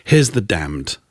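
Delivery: low, understated gloomy DJ delivery; lugubrious. Retold or imitated. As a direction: lightly imitate a mournful newsreader, without the accent. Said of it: "in his lugubrious tones".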